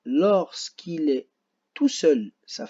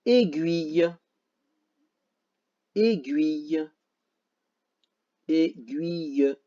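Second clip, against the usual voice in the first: slow demo; slower than usual. Shown as a demonstration. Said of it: "Là où il y a le son G".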